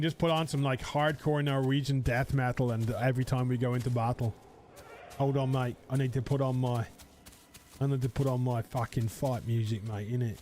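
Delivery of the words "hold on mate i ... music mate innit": put-on Essex geezer accent; British Essex accent. Streamer plays a headbanger Apache from Essex, British accent. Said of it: "We go a British accent".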